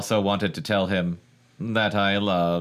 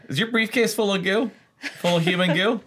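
mock robot voice; 12 semitones higher than usual. Mocking the robot's suitcase.

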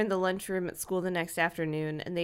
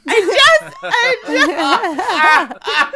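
much higher, louder half-laughing fake cry; mock sobbing; 9 semitones higher, 9 dB louder, much slower than usual. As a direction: hams up a breakdown, very slow at the end. [name] upset, can't help it.

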